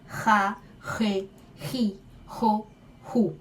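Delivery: syllables ra re ri ro ru with a raspy throat jota; scraped jota drill. Shown as a demonstration. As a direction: run ra to ru, scraping each. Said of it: "arranhada como re".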